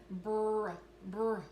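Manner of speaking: brr held as one blended sound; blended starting sounds. Shown as a demonstration. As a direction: stretch out the br in brr. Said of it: "let's go ahead and stretch that out".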